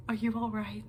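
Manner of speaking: soft, hushed, caring question; gentle concern. Retold or imitated. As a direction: ask it softly, like a kind bear checking in. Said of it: "being like, Gregory".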